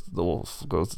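usual speaking voice

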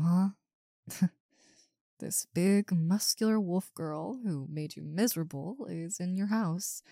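ordinary talk

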